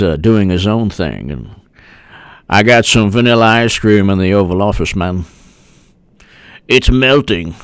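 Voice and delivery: low hammed-up old statesman; mock old politician. Same character continues.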